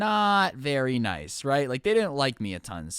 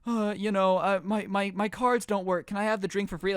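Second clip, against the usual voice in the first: higher, hesitant pleading act; put-on hard-luck plea; mock sob story; 5 semitones higher than usual. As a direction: imitate someone stammering out a sob story. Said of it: "weaving me some sob story".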